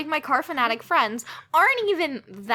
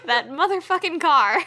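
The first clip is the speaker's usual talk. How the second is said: usual speaking voice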